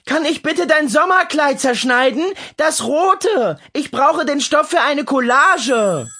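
put-on high, pleading little girl's voice; eager young girl. Lea asks her mother for the dress, high eager child. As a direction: beg like an excited kid.

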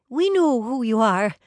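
loud, high, eager; gushing fan. A narrator's reading. Brianna pushing forward to greet the twins, gushing. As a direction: gush at your idols, high and loud.

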